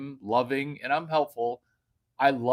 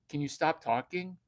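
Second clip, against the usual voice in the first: normal talking voice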